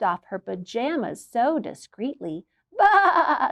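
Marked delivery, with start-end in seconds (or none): 2.8-3.5 high laughing bleat; sheep bleat. Acted. Shy sheep bleating at peekers. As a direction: bleat with a giggle. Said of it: "said the sheep".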